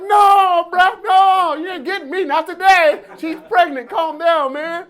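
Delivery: high, loud, laughing-crying pleas; loud laughing plea to himself not to get passed; mock panic. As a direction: ham up the pleading with yourself.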